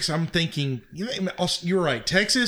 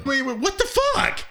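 mock shock; 12 semitones higher than usual. Acting out proper response.

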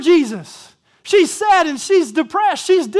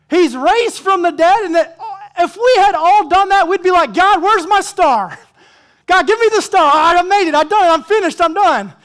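laughing, higher and louder mock boasting; excited, laughing; 5 semitones higher, 5 dB louder than usual. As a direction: play the proud show-off, laughing through it.

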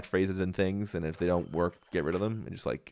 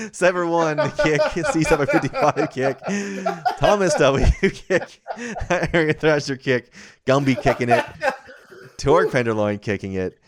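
giggling through the names; laughing while reading out; 6 semitones higher, 4 dB louder than usual. Real, not acted.

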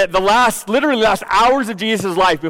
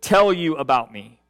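scripture reading; 3 semitones lower than usual. Acted. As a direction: read the passage with hushed reverence. Reads scripture aloud.

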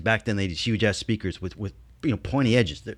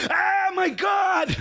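shocked scream; much slower than usual. Retold or imitated. Raphael sees the wound.

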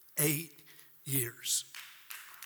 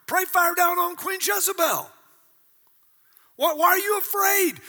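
higher, loud, incredulous scolding; exasperated God; 9 semitones higher, 4 dB louder than usual. Retold or imitated. Preacher playing God, scolding Elijah loudly. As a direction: shout at Elijah like a fed-up God. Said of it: "if I was God, I'd say".

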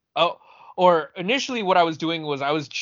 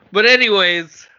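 loud; 6 semitones higher, 8 dB louder than usual. Real, not acted. Recovering after laughing fit.

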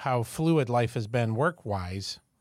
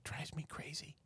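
whispered aside; whispering; 4 semitones higher, 18 dB quieter than usual. Real, not acted. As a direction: whisper it under your breath. Unvoiced aside.

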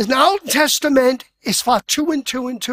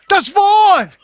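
more enthusiasm; 7 semitones higher, 7 dB louder than usual. Shown as a demonstration. Preacher urging the congregation, shouting with enthusiasm. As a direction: shout it out, higher and louder. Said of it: "Auch sagt das mit ein bisschen mehr Enthusiasmus".

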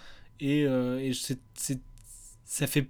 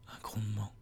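low dramatic whisper; 6 semitones lower, 11 dB quieter than usual. Acted. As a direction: whisper it like a menace. Reads the review dramatically.

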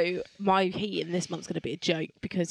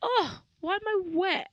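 confused; 13 semitones higher than usual. Retold or imitated. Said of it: "So I was like".

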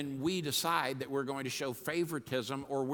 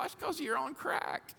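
much higher mocking thought; sarcastic inner retort; 12 semitones higher, 3 dB quieter than usual. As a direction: toss off a cheeky put-down. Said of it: "And I thought".

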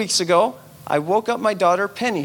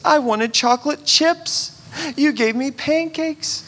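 put-on whiny child; 9 semitones higher than usual. Penny's complaints.

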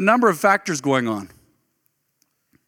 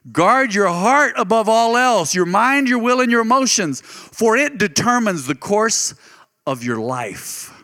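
slow, weighty reread that stresses heart; expanded solemn reading; 4 semitones higher than usual. Acted. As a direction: draw out "Guard your heart above all else" and fade at the end. Rereads verse with his gloss.

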